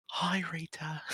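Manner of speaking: hushed, breath-only hello; soft whispered reply after a shush; whispered greeting. Acted. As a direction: whisper hello in a quiet library.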